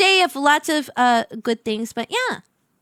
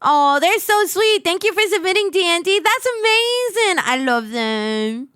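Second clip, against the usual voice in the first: usual speaking voice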